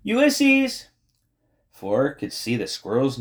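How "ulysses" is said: high, loud call out the window; raised, drawn-out shout. A narrator's reading. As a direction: call the name out to someone far off. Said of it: "Tootie shouted out her open window".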